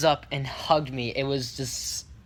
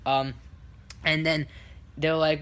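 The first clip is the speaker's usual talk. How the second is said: ordinary delivery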